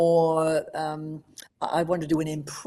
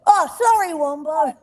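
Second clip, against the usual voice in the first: high, loud mock apology; cheeky Blinky; 11 semitones higher, 6 dB louder than usual. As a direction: apologise sweetly while teasing. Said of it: "and Blinky would say".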